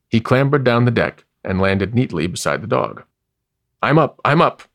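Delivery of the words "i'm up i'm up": high, louder startled protest; just-woken dog insisting he's awake. A narrator's reading. Dog jolts awake and insists to the baboon, loudly. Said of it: "insisted the dog".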